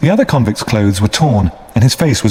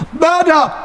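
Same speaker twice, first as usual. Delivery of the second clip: a much higher desperate cry for help. A narrator's reading. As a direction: scream it across the marshes. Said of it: "one cried".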